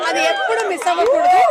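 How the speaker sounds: high, ringing emphasis on the whole line; emphatic point. Real, not acted. Guest urging the audience to keep smiling, high and emphatic. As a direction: drive the message home to the crowd.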